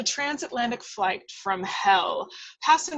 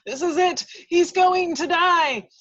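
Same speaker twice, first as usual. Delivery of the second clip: higher, louder, building dread; panicked narration; 9 semitones higher, 8 dB louder than usual. A narrator's reading. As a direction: sell the terror.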